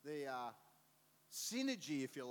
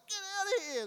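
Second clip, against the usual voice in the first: very high, loud command; yelled order; 8 dB louder than usual. Retold or imitated. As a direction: chase them off. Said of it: "and he's like".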